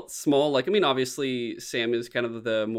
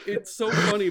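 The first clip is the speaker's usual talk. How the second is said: ordinary talk, nothing marked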